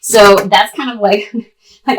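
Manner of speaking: normal talking voice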